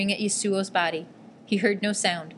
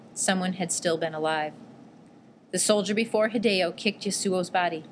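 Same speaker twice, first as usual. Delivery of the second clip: plain reading voice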